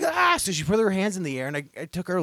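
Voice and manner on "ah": startled yelp. Retold or imitated. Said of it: "she was like".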